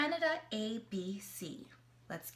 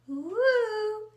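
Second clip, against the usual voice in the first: woo; 9 semitones higher, 8 dB louder than usual. Acted. Tracing big C in the air.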